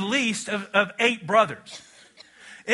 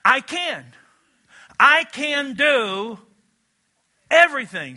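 I can do everything, slow and rising in force; proclaimed slowly; 3 semitones higher, slower than usual. Shown as a demonstration. Preacher declaring God's promise to the church, slow and emphatic. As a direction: declare it word by word with building weight. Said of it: "This is what God says".

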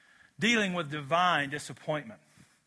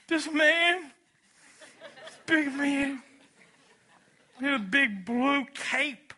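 put-on higher innocent storytelling child; lightly voiced kid; 7 semitones higher than usual. Big brother telling the parent a made-up story, lightly voiced child. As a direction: spin the fib wide-eyed. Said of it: "child's tall tale".

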